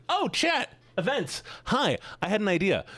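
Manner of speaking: high, sudden excited call; excited outburst. Real, not acted. Streamer calling chat with an idea, excited and high.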